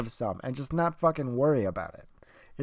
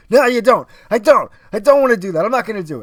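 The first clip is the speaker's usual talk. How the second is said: higher, much louder mock argument; emphatic protest; 6 semitones higher, 10 dB louder, faster than usual. Arguing with self over grabbing views, emphatic protest. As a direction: blurt out a loud, insistent denial.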